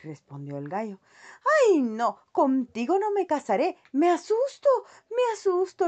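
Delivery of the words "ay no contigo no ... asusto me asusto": asustada. A narrator's reading. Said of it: "con un tono muy indiferente".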